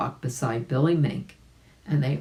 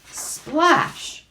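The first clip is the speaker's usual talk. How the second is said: splash sound effect; 13 semitones higher, 3 dB louder than usual. A narrator's reading. Narrator voices the splash.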